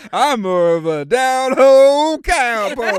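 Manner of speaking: loud down-home cowboy; Woody. Acted. Cowboy toy introducing himself, loud Woody impression. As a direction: play the cowboy toy, projected loudly. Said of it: "that's our boy Woody".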